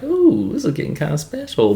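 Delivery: a high, sing-song "ooh" sliding into a quick teasing aside; mock swooning gush. Teasing the viewer about the flirting chimps, a high mock swoon. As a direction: coo it like you're teasing a crush.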